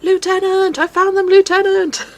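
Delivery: high, loud shout of Lieutenant; Rolf calling out. Retold or imitated. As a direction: shout it like a young soldier who found them. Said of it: "he's like".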